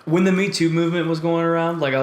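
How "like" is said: ordinary talk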